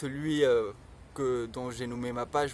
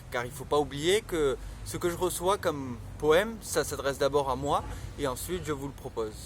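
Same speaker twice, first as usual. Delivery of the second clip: ordinary delivery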